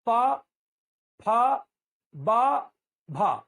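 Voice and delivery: प फ ब भ each said alone with a pause, loud, lips popping; model lip consonants. Shown as a demonstration. Teacher asks the class to repeat: प फ ब भ, clear and loud. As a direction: drill प, फ, ब, भ with strong puffs of air. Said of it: "आप स्वयं बोलिए".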